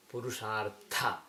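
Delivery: "Purushartha" slow with an aspirated "tha"; correct aspirated tha. Shown as a demonstration. Teacher slowly models the correct Purushartha. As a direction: slowly land a breathy t. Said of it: "not Purushartha, but".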